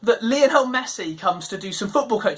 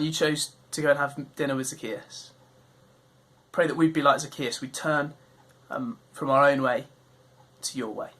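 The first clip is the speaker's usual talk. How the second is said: prayer; 7 semitones lower than usual. Acted. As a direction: pray gently to close the talk. Leads a closing prayer.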